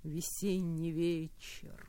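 slow, soft verse reading; poem recital. Acted. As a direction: read it tenderly, slowly.

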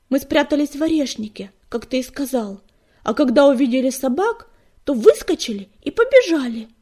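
high, loud, boastful kitten voice; loud and lifted with pride; proud youngster. A narrator's reading. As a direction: report it proudly, like an eager young apprentice. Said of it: "гордо заявил Уголёк".

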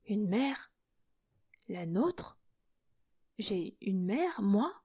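hushed, incredulous questions rising at the end; faint with shock. A narrator's reading. Young woman learning she has a mother, near fainting. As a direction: barely dare to ask, softly stunned. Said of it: "en pâlissant à croire qu'elle allait défaillir".

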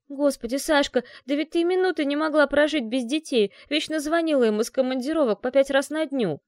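agitated pleading. A narrator's reading. Heroine upset at friend's coldness.

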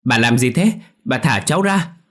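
put-on struggling boy yelling; boy shouting. A narrator's reading. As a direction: yell to be let go. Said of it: "Lúc này nó hét".

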